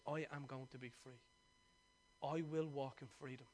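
low, very soft recital of a vow; solemn quoted vow. Retold or imitated. Preacher retells Mandela looking out the cell window, solemn quoted vow. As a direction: quote it quietly and low, with measured resolve.

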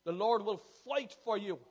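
slow thundering pulpit declaration; loud proclamation. Acted. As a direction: boom it out as a promise. Proclaimed, against later quiet take.

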